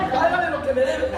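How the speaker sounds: high, loud hard demand; angry demand. Acted. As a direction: shake him for the money. Servant choking debtor, acted again.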